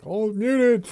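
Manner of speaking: high, loud mock outcry; mock exclamation. Streamer imitates a late chat reaction, mock exclamation. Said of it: "Arca's like".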